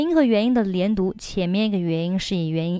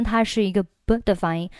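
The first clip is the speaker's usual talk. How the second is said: ordinary talk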